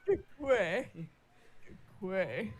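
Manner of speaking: squeaky creature chirp; Kikwi voice. Retold or imitated. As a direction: chirp it like a tiny forest creature. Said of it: "Stop making quick noises".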